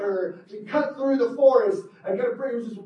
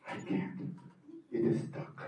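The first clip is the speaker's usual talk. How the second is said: hushed stuck voice; 14 dB quieter than usual. Acted. Fernando trapped, hushed.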